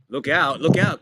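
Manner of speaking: loud rising call of look out; urgent warning shout. Real, not acted. Commentator shouting a warning at a loose ball.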